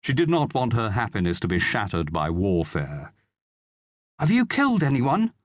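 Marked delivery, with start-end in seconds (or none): 4.2-5.3 put-on high-pitched, wondering child's voice; wide-eyed boy. A narrator's reading. Son asking soldier father about killing, wide-eyed. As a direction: ask like an awed young lad. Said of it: "asked the boy, wide-eyed".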